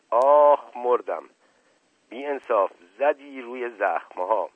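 loud, slow pained howl; cry of pain. Acted. Hit on his wound, crying out loud in pain. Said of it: "فریاد دوست علی خان".

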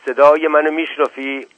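loud, high called-out question; shouting. A narrator's reading. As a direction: call out to someone who may not hear. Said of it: "زاهد دوباره داد زد". (با داد و صدای بلند)

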